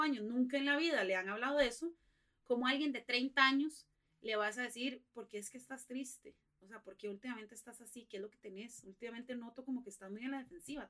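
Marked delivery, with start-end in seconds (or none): 5.1-10.9 gentle concerned questioning. Acted. Asking someone why they are sad, softly and gently. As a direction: ask quietly, as if checking on someone.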